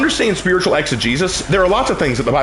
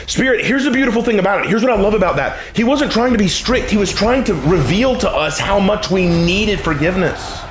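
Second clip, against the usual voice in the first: raised pitch, emphatic sermon delivery; impassioned preaching; 7 semitones higher than usual. Real, not acted. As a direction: preach it hard, landing each point. Preaches with acted quotes.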